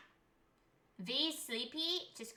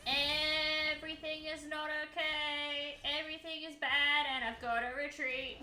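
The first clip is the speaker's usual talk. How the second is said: mock despair; 7 semitones higher than usual. In-game dramatic lament.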